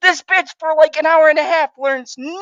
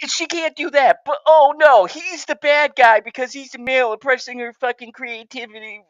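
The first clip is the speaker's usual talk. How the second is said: ordinary talk, nothing marked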